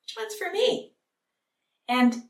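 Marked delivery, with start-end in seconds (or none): 0.0-0.9 high-pitched mimicked child asking; little children. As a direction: imitate a little kid wondering about themselves. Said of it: "That's what they're gonna do".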